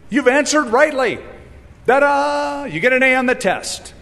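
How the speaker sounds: high, bright cheer; playful praise. Acted. Preacher voicing Jesus congratulating the lawyer, high and cheery. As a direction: cheer him on like a game show host.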